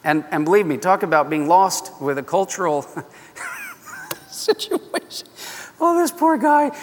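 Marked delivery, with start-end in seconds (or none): 3.6-6.8 laughing through the word, voice jumping high. Real, not acted. Preacher laughing at Jacob's wedding night mix-up. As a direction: laugh it out in amused disbelief.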